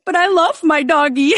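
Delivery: high mock-plaintive protest; wounded dog owner. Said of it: "And I was just like".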